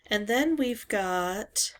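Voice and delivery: ordinary talk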